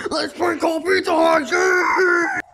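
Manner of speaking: high-pitched gleeful shout; loud squealing enthusiasm; excited cheering. Acted. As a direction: whoop it up over a prank. Friends cheer a prank call idea.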